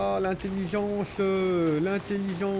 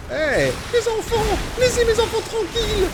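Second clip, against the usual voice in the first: mock monster mother. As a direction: shriek like an outraged monster mom. Voicing the creatures' mother. (voix de mère monstre très aiguë et lente)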